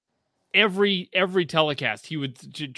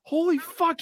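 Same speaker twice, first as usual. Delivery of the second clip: shocked surprise; 14 semitones higher than usual. Retold or imitated. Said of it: "I'm like".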